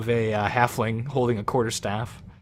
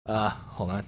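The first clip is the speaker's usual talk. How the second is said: ordinary talk, nothing marked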